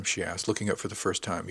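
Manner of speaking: plain reading voice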